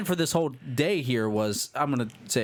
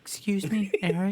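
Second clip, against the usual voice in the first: higher-pitched, timid call; lightly acted nervousness, pitched up; mock nervous roommate; 5 semitones higher than usual. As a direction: imitate a confused, nervous phone call. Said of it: "he was confused and nervous".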